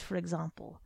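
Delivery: ordinary delivery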